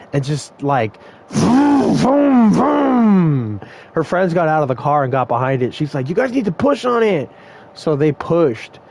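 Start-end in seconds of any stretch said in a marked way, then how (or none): 1.3-3.6 drawn-out vrooms rising and falling high; revving engine imitation. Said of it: "trying to rev it really hard". 6.1-7.3 high-pitched imitation of the stranded driver; retold urgent order. Retold driver ordering friends to push.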